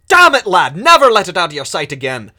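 loud, fast, furious scolding; angry scolding. Acted. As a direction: yell at the careless boy. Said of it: "cuffs you across the ear".

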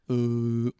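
slow, dopey mumble; dull lunk. Retold or imitated. Mocking the boring guy refusing to dance, slow and dopey. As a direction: play a boring guy dodging flirting. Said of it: "he was just like".

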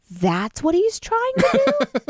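high, slow disbelieving question; mock baffled, can't believe that's the attempt; incredulous realization. Host mocking the actor's accent attempt, high incredulous disbelief.

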